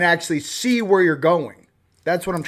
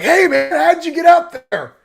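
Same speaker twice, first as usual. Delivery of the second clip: high, loud, put-on movie hero voice; hammed-up action hero shouting up; Kurt Russell; 11 semitones higher, 6 dB louder than usual. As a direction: call up to the old man, impressed. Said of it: "Kurt Russell's like".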